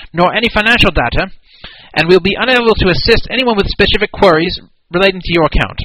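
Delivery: hammed-up British customer service voice; British accent. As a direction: read the email as a stuffy British clerk. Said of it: "I gotta do this in the right accent".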